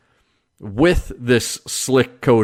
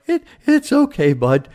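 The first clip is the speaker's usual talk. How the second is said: much higher, tender reassurance to the dog; tearful reassurance; 12 semitones higher than usual. Retold or imitated. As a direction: soothe the worried dog while fighting tears. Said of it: "and I'm like".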